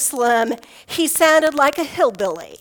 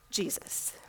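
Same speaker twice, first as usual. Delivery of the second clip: whispered; 19 dB quieter than usual. Acted. Hushed ending of fearful confession.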